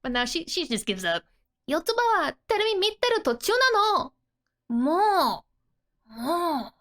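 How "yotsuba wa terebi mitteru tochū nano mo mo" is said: put-on higher, louder, fast childish huff; annoyed child. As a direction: whine at being interrupted, a bit fast. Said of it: "She's so angry".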